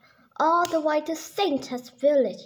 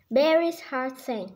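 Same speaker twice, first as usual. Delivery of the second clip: ordinary delivery, nothing marked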